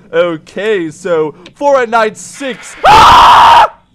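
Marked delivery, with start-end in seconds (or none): 2.9-3.8 long, high, loud scream; startled scream. Real, not acted. Player starting Night Six is startled, high scream. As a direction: scream at a jump scare.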